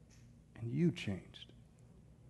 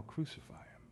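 very soft, breath-only cry; whispered mock yell; 12 dB quieter than usual. Preacher challenging believers, crowd's yell imitated in a whisper. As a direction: whisper what should be a yell. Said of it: "Or will you yell".

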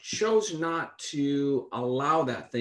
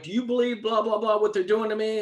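higher, lightly mocking a rattled friend; upset friend; 6 semitones higher than usual. Preacher mimics worried friend, mild mock anxiety. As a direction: play the friend's anxious complaint, lightly. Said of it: "he's like".

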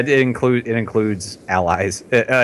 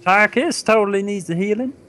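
high, goofy simpleton voice; squeaky falsetto simpleton; bizarre falsetto; 10 semitones higher than usual. Pretend. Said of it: "bizarre falsetto".

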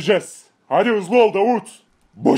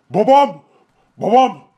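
loud, urgent calls; alarmed calling; 5 dB louder than usual. Acted. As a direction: try to rouse the father. Calling the hurt father. (telaşla bağırarak seslenme)

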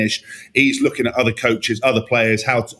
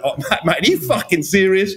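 mock outraged coach; 6 semitones higher than usual. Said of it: "you know, that".

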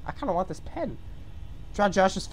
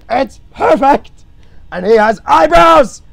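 loud, high proclamation; hammed-up high holler; triumphant shouting; 8 semitones higher, 12 dB louder than usual. Real, not acted. Triumphant shouting over the drawing.

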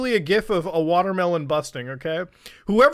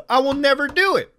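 pushed harder, a touch louder; louder repeat; 5 semitones higher, 4 dB louder than usual. Real, not acted. Refusing Funimation Premium again, said with more force. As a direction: drive the refusal home a second time. Vows never to pay, repeats it.

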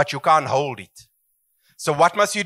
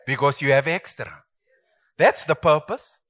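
normal talking voice

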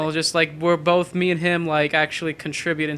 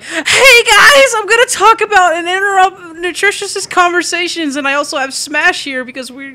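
loud, squeaky-high mocking caricature; Moose impression; 12 semitones higher, 8 dB louder than usual. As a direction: parody an over-eager host interrupting, very high. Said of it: "The Moose is like".

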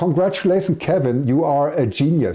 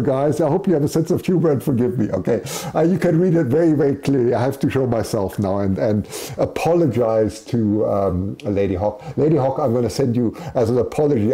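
usual speaking voice

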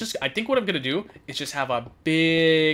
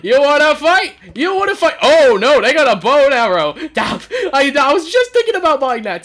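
loud challenge; 8 semitones higher, 10 dB louder than usual. Real, not acted. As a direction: taunt rising into high, loud alarm. Challenges attacker, then alarmed.

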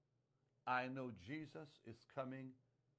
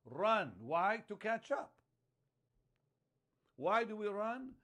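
loud, higher rhetorical preaching bursts; preaching shout; 7 semitones higher, 8 dB louder than usual. Real, not acted. Preacher calling out to the congregation, loud and higher. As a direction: call out each question to the congregation.

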